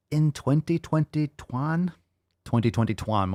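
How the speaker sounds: twenty twenty twan with twan said like twat; joke mispronunciation. Shown as a demonstration. Fumbling the year on purpose, saying twan. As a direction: drag out twan as a silly slip. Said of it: "I wanna say twat".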